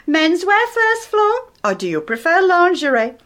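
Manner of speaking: mock lift attendant. [name] teasing Edith in the lift, mock lift-attendant announcement. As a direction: ham it up like calling out store floors.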